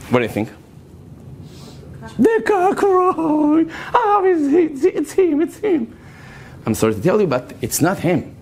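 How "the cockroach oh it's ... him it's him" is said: high, drawn-out excited guess; eager mock answer. Teacher voicing the girls answering her question, high and excited. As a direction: blurt it like a keen pupil.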